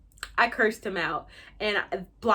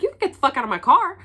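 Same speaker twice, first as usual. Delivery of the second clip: fast, loud, higher-pitched snap; heated; 4 semitones higher, 6 dB louder, much faster than usual. Retold or imitated. Retelling how she told her date off, heated. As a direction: order them out, fired up. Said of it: "I was heated".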